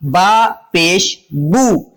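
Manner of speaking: slow "با پیش بو", ending on a long oo; name letter and mark apart, then stretch "بو" as boo; spelling out vowel sound. Shown as a demonstration. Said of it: "پیش کی آواز نکلتی ہے او کے ساتھ".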